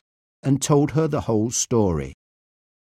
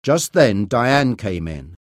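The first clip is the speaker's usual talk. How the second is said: usual speaking voice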